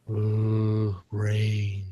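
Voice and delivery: low, slow zombie moan; zombie groan. Pretend. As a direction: drone it out like a dull zombie. Zombie answers in story.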